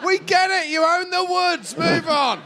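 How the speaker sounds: heckling. Pretend. Mock heckling old Barry.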